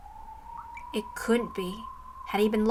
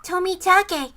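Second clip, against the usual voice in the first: put-on girl's call; 8 semitones higher, 3 dB louder, slower than usual. Girl spots the captive man.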